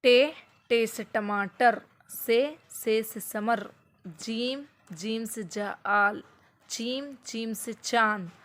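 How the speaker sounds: slow, drawn-out letter drill; slow chant of alphabet words; slow teaching drill. Shown as a demonstration. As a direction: chant each word slowly for a child. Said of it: "फिर इसी तरीक़े से".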